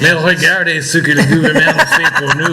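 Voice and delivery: the government line redone in French, amused; French accent. Retold or imitated. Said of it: "you gotta say that in a French accent".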